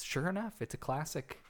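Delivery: ordinary talk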